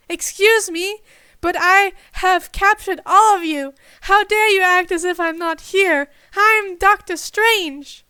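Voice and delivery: loud, high, affronted villain voice; indignant villain. A narrator's reading. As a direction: puff up with outrage. Captor indignant at being ignored.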